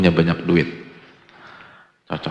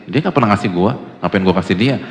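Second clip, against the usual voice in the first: louder, indignant stingy refusal; mock indignant; 7 dB louder than usual. Preacher voicing a stingy man refusing the poor, loud and indignant. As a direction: scoff loudly at giving.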